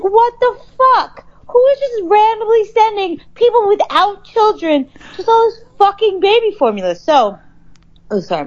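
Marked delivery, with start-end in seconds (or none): 0.0-6.9 high-pitched, exasperated mock scream; outraged screaming. Ranting to friend about unwanted formula samples, mock screaming. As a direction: wail it in retold outrage, pitch way up. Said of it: "my throat is dry from screaming".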